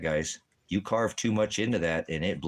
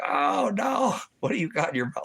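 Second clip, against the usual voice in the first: surprised dismay; 3 dB louder than usual. Retold or imitated.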